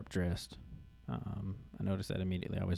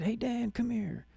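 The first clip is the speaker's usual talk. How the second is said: higher, slow call across the crowd, lightly voiced; calling someone over; slower than usual. Son calling Dan over across the crowd, higher slow call.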